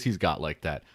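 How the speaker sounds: ordinary talk, nothing marked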